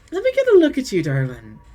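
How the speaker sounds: high fond exclamation; doting lady. Acted. Deirdre admiring Saoirse after the hug. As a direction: look her over adoringly.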